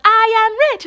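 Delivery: high, loud chant back; crowd shouting back. Retold or imitated. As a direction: echo it like a roaring crowd.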